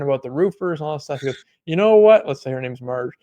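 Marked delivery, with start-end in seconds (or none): 1.7-2.3 loud, high mock yell into the phone; imitating a yell. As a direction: belt it out like an angry guy on the phone. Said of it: "he's yelling at her on the phone".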